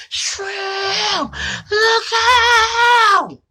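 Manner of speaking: high, loud scream of warning; a frantic shout to a friend in danger; desperate warning yell. Retold or imitated. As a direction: yell it like your friend's life depends on it. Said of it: "hearing the intensity in my homie voice".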